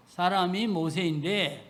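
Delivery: ordinary talk, nothing marked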